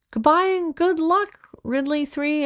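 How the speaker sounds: high, loud farewell call; child echoing her mother's shout to the plane; echoed shout. A narrator's reading. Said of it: "echoed Mimi".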